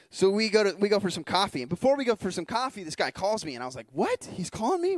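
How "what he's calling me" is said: high, amazed questions; can't believe he called; surprised delight. Retold or imitated. Surprised the new friend calls.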